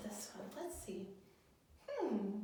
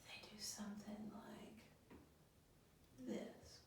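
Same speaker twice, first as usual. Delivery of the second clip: whisper; 13 dB quieter, much slower than usual. Shown as a demonstration. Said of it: "Or if they're angry".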